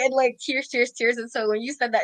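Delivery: ordinary talk, nothing marked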